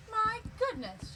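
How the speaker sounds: put-on sweet old woman amazed; high slow astonished old woman. A narrator's reading. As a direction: gasp it out like a kindly old lady. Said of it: "she said with a shake of her head".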